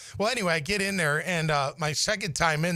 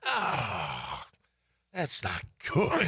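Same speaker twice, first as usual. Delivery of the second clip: dismayed groan; 7 semitones lower, much slower than usual. Retold or imitated. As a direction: let it drop as you see the injury. Sees his torn bicep.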